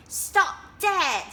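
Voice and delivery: high, loud quacking shout; quacked order. Acted. Duck ordering the racing dragonflies, loud quack. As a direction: bark it like an angry duck. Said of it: "he quacked".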